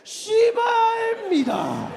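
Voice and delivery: high, loud and slow polite curse; drawn-out shouted curse with a polite ending; polite angry yell. Retold or imitated. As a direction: yell the curse but keep it formal. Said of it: "still real polite".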